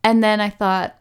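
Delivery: ordinary delivery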